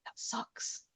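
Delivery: whispered, hushed comfort; whispered sympathy. Retold or imitated. Owner comforting her frightened dog, whispered. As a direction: breathe it softly, no voice. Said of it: "I just went".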